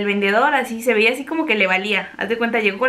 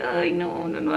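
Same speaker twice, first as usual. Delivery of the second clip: high, soft, flustered denial; mock embarrassed protest; 6 semitones higher, 6 dB quieter, slower than usual. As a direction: squirm with embarrassment. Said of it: "así como un buen de pena".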